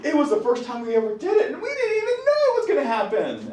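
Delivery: put-on high-pitched pleading teen excuse; whiny high schooler. High schoolers explaining a surprise pregnancy, mock whiny. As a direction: imitate a teenager wailing an excuse, pitch climbing. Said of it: "when I work with high schoolers".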